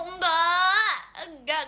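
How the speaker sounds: loud, high drawn-out denial; mock protest. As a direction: whine out a big playful objection. Denies being sulky.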